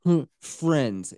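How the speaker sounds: normal talking voice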